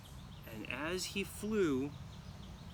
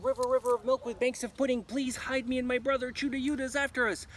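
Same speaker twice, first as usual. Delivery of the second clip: fast, loud, higher desperate plea; urgent plea; 10 semitones higher, 6 dB louder, faster than usual. Acted. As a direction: beg the river in a hurry. Girl begs river while fleeing.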